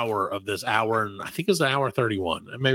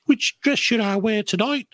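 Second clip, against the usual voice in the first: put-on proper British accent; 7 semitones higher than usual. Posh asking about dresses, mock proper British. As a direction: put on a prim British lady's voice. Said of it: "like, the proper British".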